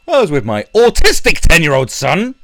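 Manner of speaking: high, loud whiny parody of a mum; mocking Mumsnet mum. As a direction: ham up the offended mother. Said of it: "Who talks like that? Going".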